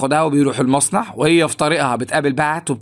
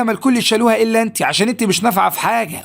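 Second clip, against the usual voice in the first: mild mock telling-off, pitched up; higher voice, lightly imitated reproach; retold scolding; 7 semitones higher than usual. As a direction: retell a boss scolding his new worker. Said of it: "بيزعق لها".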